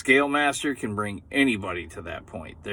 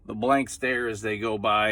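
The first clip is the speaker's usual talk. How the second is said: ordinary talk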